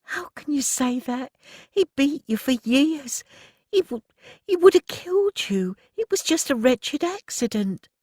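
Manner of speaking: tearful protest. Acted. Rose sobbing at Pearl, high tearful pleading. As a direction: cry it out, begging her not to. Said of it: "Rose burst into tears".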